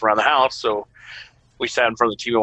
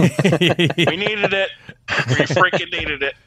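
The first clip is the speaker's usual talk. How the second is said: mock relief; 6 semitones higher, slower than usual. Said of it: "I'm just joking".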